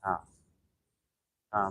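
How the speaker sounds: ordinary delivery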